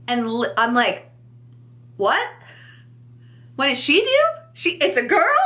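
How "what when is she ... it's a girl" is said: high, startled double-take; stunned disbelief. Retold or imitated. Mum caught off guard by the clerk's slip, in high startled disbelief. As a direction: echo the question in shock. Stunned by accidental gender reveal.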